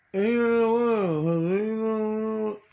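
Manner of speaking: loud, high, drawn-out imitation; imitated taunting voice. Man imitating what this guy said, slow mocking imitation. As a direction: stretch each word out high and loud, mimicking.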